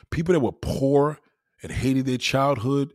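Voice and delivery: ordinary talk, nothing marked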